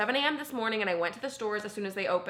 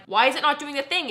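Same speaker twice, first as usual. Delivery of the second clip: frustrated; 5 semitones higher, 5 dB louder than usual. Retold or imitated. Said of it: "And I just am like".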